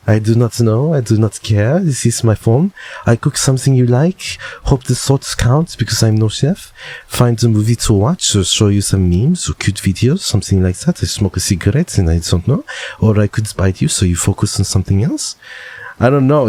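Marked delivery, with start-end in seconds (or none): 0.1-6.7 put-on French accent, phrases lilting upward; higher, teasing French-accented voice. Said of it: "I wonder if they have a French accent". 7.1-10.2 put-on French accent, lilting upward; hammed-up French-accented reading. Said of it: "I wonder if they have a French accent". 10.2-15.4 put-on French accent; mock French speaker rambling on; hammed-up French-accented reading. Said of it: "I wonder if they have a French accent".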